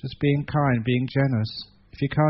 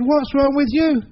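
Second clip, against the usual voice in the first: a high, bright-voiced happy spider asking; high cheerful spider; 11 semitones higher, 5 dB louder than usual. Happy spider asks the crying spider, high and cheerful. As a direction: pitch it up, cheerful and curious.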